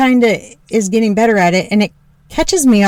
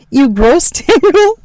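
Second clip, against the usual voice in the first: laughing; 7 semitones higher than usual. Real, not acted. Joking laughing aside.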